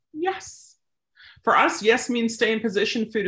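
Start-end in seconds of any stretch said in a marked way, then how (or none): 0.1-0.8 high airy "Yes" marker. Shown as a demonstration. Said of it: "mark it".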